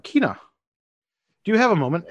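calling out. Acted. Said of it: "And you hear him shout".

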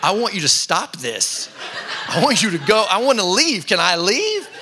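a laughing, half-desperate plea to escape. Retold or imitated. Retold awkward moment, laughing.

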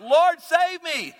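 high, slow cry for rescue; prayer of panic. Acted. Sinking Peter to Jesus, high panicked cry. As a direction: cry out, stretching the plea. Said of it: "Again, the prayer of panic".

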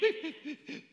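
high, soft mouth-made beeping; imitated monitor beeps. Preacher on living past death, imitated monitor beeps. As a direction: mimic the heart monitor pips.

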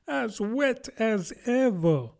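high, slow, forlorn Alice; melancholy. A narrator's reading. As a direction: complain mournfully in a high voice. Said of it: "in a melancholy tone".

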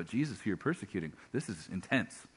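hushed, as if shaken by what they heard; awed hush. Acted. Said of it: "Now all the other guys go".

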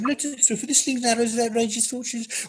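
high Brummie voice, tossing in everyday asides; Brummie Shakespeare. Shown as a demonstration. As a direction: recite Hamlet as a chatty Brummie. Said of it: "in a Brummie accent".

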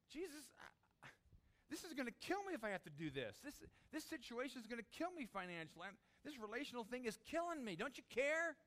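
high, strained complaint rising to a loud question; desperate plea. Acted. Believer cries to Jesus about troubles, desperate and high. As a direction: plead upward, building to a loud finish. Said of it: "the desperation of".

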